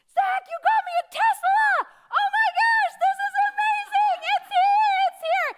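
huge high shouts of joy, far above normal; excited yelling. Retold or imitated. Yelling to the guy in the driveway, thrilled shout. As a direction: scream with delight like the gift just arrived.

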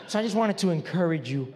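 low, lightly voiced sweet lady; a hint of a well-meaning church lady; quoting a church lady. Church lady encouraging the pastor after the sermon, lightly voiced. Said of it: "She goes like".

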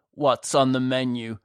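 mock repeat of a guest's question. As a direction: throw the question back with scorn. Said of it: "he mocked".

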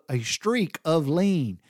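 "a streak of lean" drawn out slowly, each word separate; spelled-out phrase. Shown as a demonstration. Explaining what Daddy's word meant, slowly separated words. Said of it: "one of them words that means strick".

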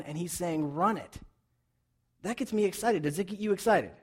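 loud, high, rousing sermon voice; preacher firing up the church; fired-up preaching. Acted. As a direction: build excitement in the room.

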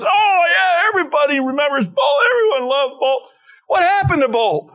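high-pitched, excited reminiscing; George Bush lighting up. Retold or imitated. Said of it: "George lit up and he goes".

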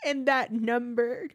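slow, emotional recollection near tears; tearful. Real, not acted. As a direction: draw it out, moved and fragile. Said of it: "I cry every time I tell this story".

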